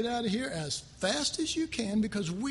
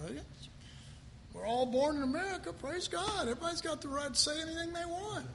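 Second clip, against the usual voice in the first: higher, fast breezy patriotic gush; mock cheerful patriot; 6 semitones higher, 4 dB quieter, faster than usual. Pastor mocking churchgoers who praise free speech, fast and breezy. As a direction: rattle it off like a smug flag-waver. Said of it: "I'm sorry".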